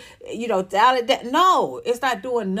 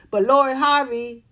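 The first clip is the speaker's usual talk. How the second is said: normal talking voice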